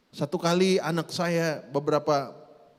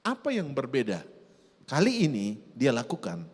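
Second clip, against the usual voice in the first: normal talking voice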